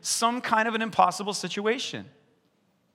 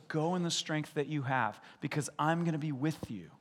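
soft, calm reassurance; God encouraging a fearful Gideon; gentle as God; 8 dB quieter than usual. Retold or imitated. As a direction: speak it gently, patiently. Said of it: "he goes".

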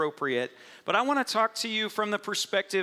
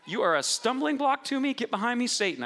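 high, sharp mock rebuke; Jesus rebuking Peter, a stern high scold; 8 semitones higher than usual. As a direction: scold him firmly but retold. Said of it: "other times he's like".

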